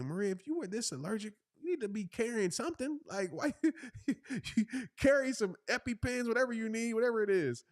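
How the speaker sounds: high-pitched comic exasperation; retold telling-off, voice way up, half laughing; incredulous scolding. Husband telling allergic wife to carry EpiPens, high exasperation.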